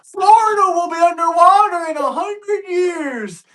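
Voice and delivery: a hammed-up alarmist, whiny and high; climate alarmist. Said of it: "crazy climate change".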